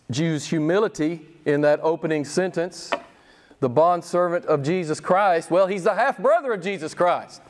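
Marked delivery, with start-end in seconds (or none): none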